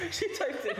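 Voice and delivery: high giggle breaking into the words; laughing out the line; laughing at what her friend typed. Real, not acted.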